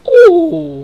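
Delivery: loud, very high "ooh"; high whoop. Real, not acted. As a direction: squeal at a near miss. Near miss on goal.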